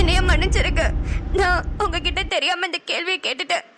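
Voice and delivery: crying as she begs forgiveness; tearful apology. Acted. Wife apologising to her man for doubting him, in tears. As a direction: apologise through tears, voice bursting out at first. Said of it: "எதுக்கு அழுவுற?".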